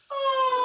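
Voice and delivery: high, loud sighing oh; big sigh. Shown as a demonstration. Coach shows the class a big sigh, loud and high. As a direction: let it all out in a sigh. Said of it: "we're gonna add a big sigh".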